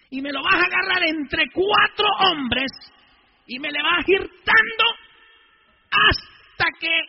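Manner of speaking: loud, high and slow, shouted order; retold shouting order to a gang leader; furious shout. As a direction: yell the violent instructions. Priest retells ordering gang leader.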